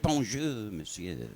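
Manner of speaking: put-on French accent. French woman greets officer.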